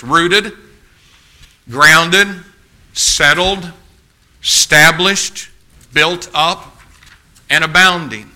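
each trait lifted high, with a pause after it; emphatic listing. Real, not acted. Preacher listing character traits to the congregation, emphatic listing. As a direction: punch out each word of the list separately.

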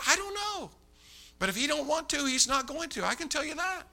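high-pitched acted helplessness; exasperated protest. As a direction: plead ignorance in a high voice. Acting his exasperated answer to Mattingly.

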